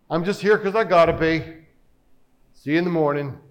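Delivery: low, offhand husband voice; mock-loving husband. Husband signing off to his wife, mock-loving and perfunctory. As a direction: toss off the affection dutifully, pitched low. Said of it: "very lovingly and says".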